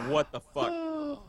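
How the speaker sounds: high, slow stunned gasp of disbelief. Retold or imitated. Kid watching friend get whooped, slow high disbelief. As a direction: drag it out, can't believe your eyes. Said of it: "I'm like".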